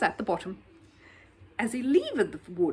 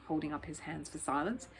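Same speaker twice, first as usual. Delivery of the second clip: ordinary delivery, nothing marked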